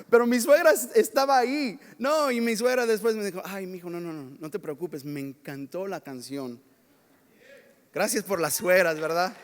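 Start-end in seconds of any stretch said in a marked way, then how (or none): 3.5-6.6 much softer, gentle reassurance; comforting mother-in-law. Retold or imitated. Mother-in-law consoling the young songwriter, soft and gentle. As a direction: soothe him like a son after a flop.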